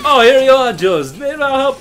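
high, loud mock Jamaican accent; cartoon diver in a Jamaican accent. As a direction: pop up cheerful in a hammed Jamaican accent. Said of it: "I don't know why he's Jamaican".

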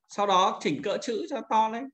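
usual speaking voice

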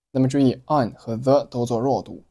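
ordinary talk

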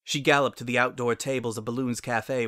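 ordinary delivery

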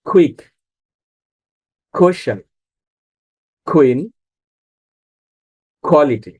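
কুইক, কোশ্চেন, কুইন, কোয়ালিটি each opening on aspirated "kh". Shown as a demonstration. Teacher reads Q examples to learners, Q as খ. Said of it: "খ এর মতো উচ্চারণ হবে".

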